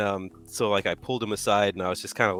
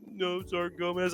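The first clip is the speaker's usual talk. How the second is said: small, higher, softer tearful reply; imitated sniffling; 7 semitones higher, 7 dB quieter than usual. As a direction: mimic a sniffly subordinate, high and quiet. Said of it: "like, sniffling".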